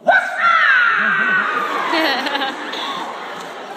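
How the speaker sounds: a big, high, loud burst of laughter. Real, not acted.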